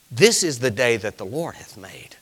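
hushed whisper of a psalm; whispered scripture. Acted. Pastor whispering scripture to his congregation. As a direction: breathe the verse in a whisper.

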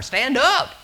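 sharp high shout, far above the speaker's own voice; commanding shout. Retold or imitated. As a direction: bark it like an order to someone flailing in water. Retold preacher rescuing from shallow water.

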